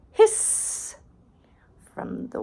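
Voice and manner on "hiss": high breathy cat hiss; sharp feline hiss; cat's hiss. Acted. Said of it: "someone said".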